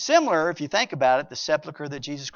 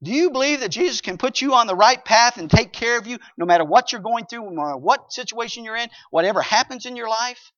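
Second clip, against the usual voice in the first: raised, urgent preacher's plea; preaching; 7 semitones higher, 8 dB louder than usual. Acted. Pastor pleading with the congregation to believe, preaching. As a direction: press the congregation for faith.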